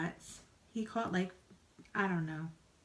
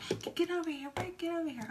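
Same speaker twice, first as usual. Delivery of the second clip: mock holler; 9 semitones higher, faster than usual. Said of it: "I'm hollering for my husband".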